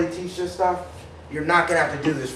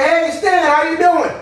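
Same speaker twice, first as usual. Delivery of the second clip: much higher, louder hey Stan; overeager greeting; 12 semitones higher, 8 dB louder, slower than usual. Shown as a demonstration. Salesman calling prospect Stan, overeager. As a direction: greet Stan as if he's an old friend. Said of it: "I'm gonna say".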